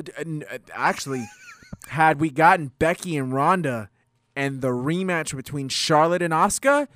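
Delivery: ordinary talk, nothing marked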